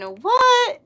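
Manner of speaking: sudden high, loud what; surprised what. Real, not acted. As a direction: blurt it out in disbelief. Reacts to blue-green rose.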